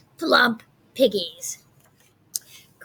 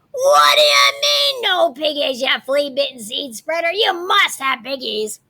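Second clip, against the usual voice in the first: much higher, loud screech, then settling; furious witch; 3 semitones higher, 4 dB louder than usual. Acted. Gritch screaming at the farmer, loud fury. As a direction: blow up at the farmer.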